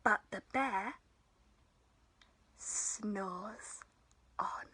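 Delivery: soft, dropping away to a near whisper; hushed narration. A narrator's reading. Storyteller says the bear sleeps on, hushed. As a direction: hush it as if not to wake the bear.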